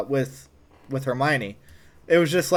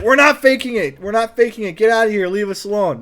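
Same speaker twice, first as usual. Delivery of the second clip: higher, louder, irritated denial; annoyed insistence; 6 semitones higher, 5 dB louder than usual. Retold or imitated. Teens shooing Paul out, irritated and raised. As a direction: shoo the kid away.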